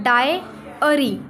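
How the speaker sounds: डायरी drawn out slowly with three syllables; slow careful demo of डायरी; model pronunciation. Shown as a demonstration. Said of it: "अब इसको हम पढ़ते क्या है".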